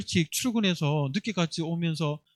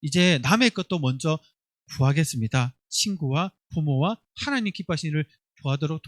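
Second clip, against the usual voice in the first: put-on a lightly raised prayer voice for the children to follow; leading prayer; 3 semitones lower than usual. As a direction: pray aloud as the example. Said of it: "우리 같이 고백합니다".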